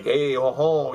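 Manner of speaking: a light put-on French accent; playful mock French accent exclamation. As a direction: toss it off in a hammy French accent. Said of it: "like, a French accent".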